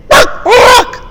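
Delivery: high loud squawked word; chicken squawk. Acted. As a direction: squawk it out like a proud hen. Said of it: "the chicken would say".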